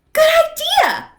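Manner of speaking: put-on a little girl thinking happily; delighted girl's voice. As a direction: pipe it up happily. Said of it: "thought Little Red".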